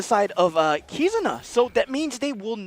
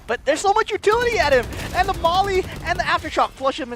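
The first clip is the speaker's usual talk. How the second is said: excited; 7 semitones higher, faster than usual. Real, not acted. Caster hype on utility.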